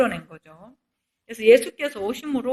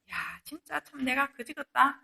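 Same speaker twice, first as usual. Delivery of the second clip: self-disgusted sigh; 8 dB quieter than usual. Retold or imitated. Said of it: "이렇게 얘기하는데".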